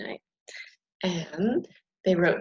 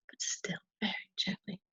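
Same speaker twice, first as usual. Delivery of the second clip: whispering; 10 dB quieter than usual. A narrator's reading. Describing gentle knocking.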